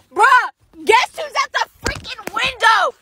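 excited. Real, not acted. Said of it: "excited storytelling".

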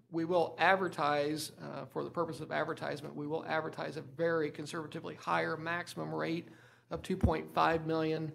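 normal talking voice

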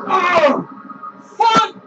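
loud, high shocked cry; startled yell. Real, not acted. Gamer caught off guard by the game, loud startled yell. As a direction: jump and yell at the scare. Sudden scare during the game.

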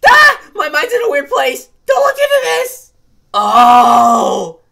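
panicked screaming. Acted.

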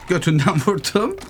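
breaking into a laugh mid-phrase. Real, not acted. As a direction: say it through a real laugh. Laughs at own shot.

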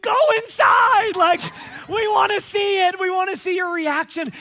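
high, loud urging shout; impatient yelling. Retold or imitated. Pastor yelling at the TV family to go in, high and loud. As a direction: yell at the TV to hurry them. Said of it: "and I'm just like".

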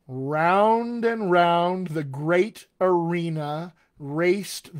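very slow and drawn out; the drill again at a crawl. Shown as a demonstration. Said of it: "let's go even slower than that".

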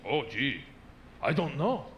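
mild, restrained politeness, a touch low; mock polite. Imitating a stranger in an awkward situation, lightly polite. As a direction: answer courteously, holding back. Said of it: "And very politely".

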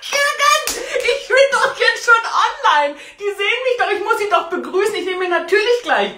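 high giggly talk through laughter; laughing. Real, not acted. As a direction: laugh while pleading. Lacht hilflos über Technikchaos.

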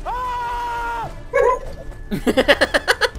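long high-pitched scream; high held shriek into laughter. Real, not acted. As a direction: scream high and sustained in the game. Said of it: "Did you hear me screaming".

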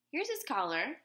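put-on boy showing his belt as a collar; boy's eager reply. A narrator's reading. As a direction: offer it proudly. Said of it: "he said".

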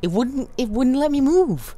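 high, loud indignant complaint; whiny protest. Real, not acted. Player complaining to viewers after dying, indignant whine. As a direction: protest you couldn't move.

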